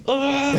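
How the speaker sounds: imitated silent toddler cry, very high; mock silent baby cry. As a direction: squeeze out a tiny breathless wail.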